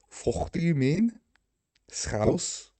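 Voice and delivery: mock Scouse with rising endings; Scouse accent. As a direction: put on a Liverpool Scouse accent. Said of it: "The Scouse one was good though".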